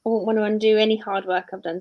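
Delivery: normal talking voice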